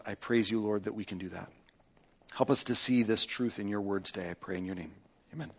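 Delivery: soft, hushed pastoral prayer. Acted. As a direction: pray quietly and reverently to God. Pastor leads opening prayer.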